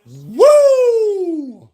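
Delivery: long, high, loud woo; celebratory whoop. Real, not acted. Fan whooping as the game is won, jubilant.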